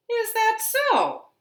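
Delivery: high teasing bird voice; robin. Acted. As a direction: ask it high and sly. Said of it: "said the robin".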